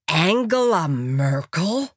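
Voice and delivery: slow, drawn-out questioning disbelief; astonished. A narrator's reading. American recognising Angela, slow astonishment. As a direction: stretch the name out in amazement. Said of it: "hielt er jedoch inne und staunte".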